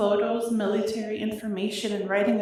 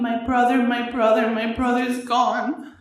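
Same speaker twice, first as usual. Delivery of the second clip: anguished cry; 5 dB louder than usual. Real, not acted. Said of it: "I screamed".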